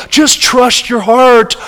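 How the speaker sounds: mocking. Preacher mocking dumb romantic advice, sing-song. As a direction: drawl it out like foolish advice. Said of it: "talk about the dumbest advice".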